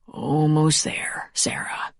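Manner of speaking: slow, soft whisper. A narrator's reading. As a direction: whisper it to comfort her. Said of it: "he whispered".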